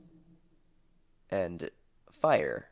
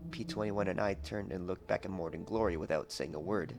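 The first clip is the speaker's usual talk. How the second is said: ordinary delivery, nothing marked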